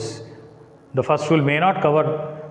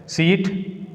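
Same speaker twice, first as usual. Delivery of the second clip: usual speaking voice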